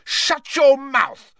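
slow, high, furious bellow. Acted. Ron cutting off his sister's taunts, bellowing. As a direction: bellow each word out in rage. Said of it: "bellowed Ron".

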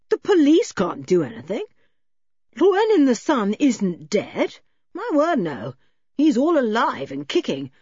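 lively, protesting character voice; emphatic, swooping protests; gruff man's indignant bluster. Acted. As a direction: bluster indignantly at unseen strangers.

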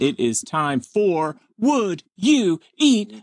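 slow announcer voice; slow game show announcer. Pretend. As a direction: announce the segment grandly.

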